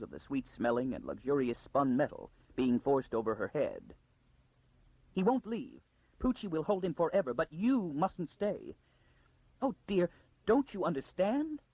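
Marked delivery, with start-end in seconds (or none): 5.1-11.6 a high, urgent frightened noblewoman; frightened lady. Acted. Frightened Lady Kalia hurrying Arcadia out, high and urgent. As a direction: press the girl to take everything and go.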